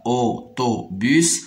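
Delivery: "Autobus" in three slow, separate syllables. Shown as a demonstration. Teacher counting out three syllables, "Autobus" slowly split. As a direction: say "Autobus" as au, to, bus with gaps. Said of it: "se compose de trois syllabes".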